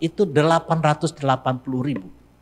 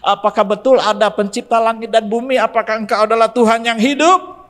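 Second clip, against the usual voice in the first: raised, higher pleading call; crying out to God; 10 semitones higher, 6 dB louder than usual. Retold or imitated. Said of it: "dia berteriak pada Tuhan orang Kristen".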